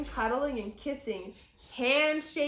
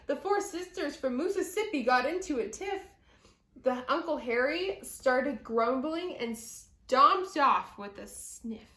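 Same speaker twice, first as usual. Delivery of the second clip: ordinary delivery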